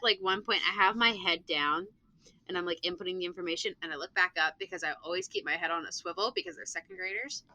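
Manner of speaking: ordinary delivery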